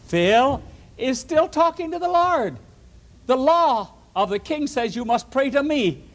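high, excited gotcha voice; gleeful tattling plotters. Acted. Schemers spying on Phil, high and gleeful. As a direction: gleefully catch Phil breaking the law.

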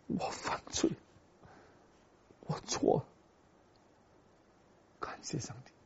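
hushed whisper of repentance; whispered confession. Acted. David confessing his sin to God, whispered. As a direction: breathe the confession almost silently.

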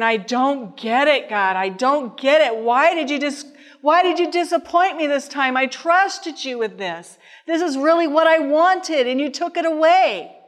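retold anguish building in pitch and force; tearful protest to God. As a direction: cry out to God, hurt and accusing. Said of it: "these tears just seem to flow".

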